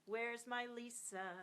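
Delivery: ordinary talk, nothing marked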